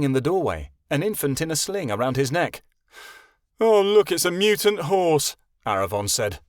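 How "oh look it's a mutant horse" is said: put-on loud, high jeer of a bratty boy; mocking. A narrator's reading. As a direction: sneer at the unicorn with a loud jab. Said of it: "rolling his eyes".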